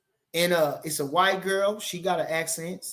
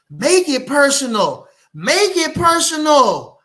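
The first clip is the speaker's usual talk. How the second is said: slower, louder and higher each time; rising shout; 6 semitones higher, 8 dB louder, slower than usual. Acted. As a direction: build it into a shout. Building chant of the phrase.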